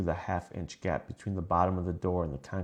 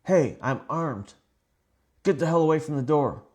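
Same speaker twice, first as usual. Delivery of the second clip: loud, high shouted warning; panicked yell; 8 semitones higher, 7 dB louder than usual. A narrator's reading. Motel guest to the intruder outside the door, panicked yell. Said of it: "and I yelled".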